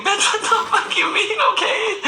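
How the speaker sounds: high, squealing laughter through the words; laughing disbelief. Retold or imitated. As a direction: shriek it out while laughing. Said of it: "I'm like".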